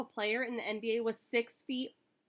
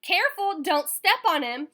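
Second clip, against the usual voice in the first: sideline shout; 7 semitones higher, 9 dB louder than usual. A narrator's reading. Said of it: "somebody shouted".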